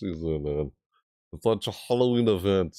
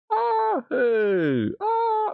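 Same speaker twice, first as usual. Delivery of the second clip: excited squeal; much slower than usual. Real, not acted. Sudden excited squeals on stream.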